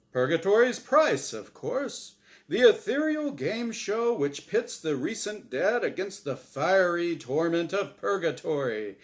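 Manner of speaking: booming, bright host voice; game show host. Acted. The angel Azrael hosting his show for Norm, big host voice. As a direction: sell it like a TV quiz host.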